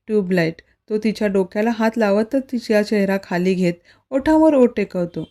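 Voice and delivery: normal talking voice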